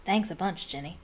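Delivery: quietly sour grumble; a touch of sour sarcasm, tailing off softly. A narrator's reading. Ron thanks his sister for nothing, sourly. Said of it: "said Ron sourly".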